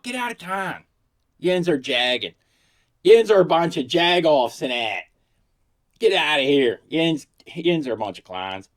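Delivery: loud Pittsburgh Yinzer scolding, "Yins" with a nasal twang; Yinzer accent. Retold or imitated. Playful Pittsburgh razzing of friends in a thick Yinzer accent. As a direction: razz them in thick Yinzer. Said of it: "I can totally imitate the accent".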